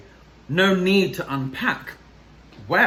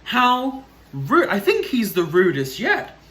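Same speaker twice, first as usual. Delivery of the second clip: higher, louder mock outrage; scandalised; 5 semitones higher, 4 dB louder than usual. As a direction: sound properly shocked. Reader judges the pig.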